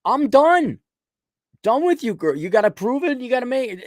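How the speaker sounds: high, loud exasperated; loud, high, exasperated brush-off; fed up. Retold or imitated. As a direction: throw up your hands, high and loud. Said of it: "I'm like".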